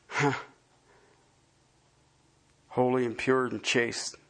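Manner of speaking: awed closing prayer. Acted.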